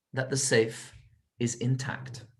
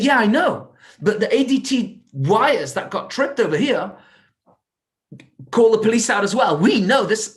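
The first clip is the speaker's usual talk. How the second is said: higher, louder cop pressing for answers; suspicious policeman; 7 semitones higher, 5 dB louder than usual. Acted. Detective confronts the late owner, loud and higher. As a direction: challenge him like a doubtful officer.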